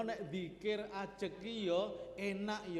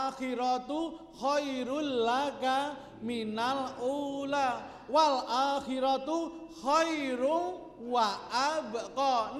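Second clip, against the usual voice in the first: slow, loud, drawn-out Arabic recitation; Quran recitation; 4 semitones higher, 6 dB louder, much slower than usual. Real, not acted. Preacher citing scripture to the congregation, slow resonant recitation. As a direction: recite the verse with long held syllables.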